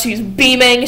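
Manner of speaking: ordinary talk, nothing marked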